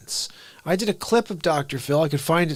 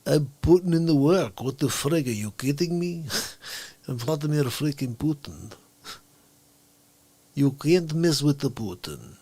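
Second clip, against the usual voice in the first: put-on lightly Russian-accented Putin; Putin impression. Putin boasting, in a mild Russian accent. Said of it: "I do it Russian".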